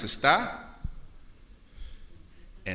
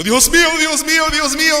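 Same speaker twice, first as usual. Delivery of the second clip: high, loud mock scream of panic; hammed-up terrified atheist shouting Dios mío; panicked cry; 9 dB louder than usual. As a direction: cry out to God in mock terror. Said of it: "ves al ateo gritando".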